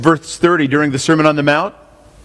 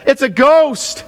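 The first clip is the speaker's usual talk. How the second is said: terrified cry; 10 semitones higher, slower than usual. Acted. Disciples terrified, shrieking in fear.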